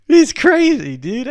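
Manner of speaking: high and loud through laughter; laughing exclamation. Real, not acted. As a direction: blurt it out mid-laugh. Still laughing at the video.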